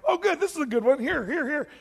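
high, loud, laughing eagerness; laughing and thrilled, jabbing at the screen; excited pointing. Acted. Man watching his life film, eagerly showing God. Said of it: "he's like".

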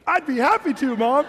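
put-on loud, high, over-eager kid's reply; convincing enthusiasm. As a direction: oversell the happy obedience to mom. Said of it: "it had to be convincing".

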